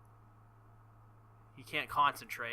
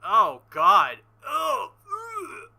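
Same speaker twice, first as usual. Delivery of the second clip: disgusted groan; 8 semitones higher, 12 dB louder, much slower than usual. Real, not acted. Reacting with disgust to scene.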